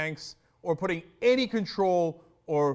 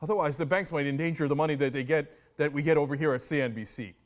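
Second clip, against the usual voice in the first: mock anchor voice; 7 semitones higher, 4 dB louder than usual.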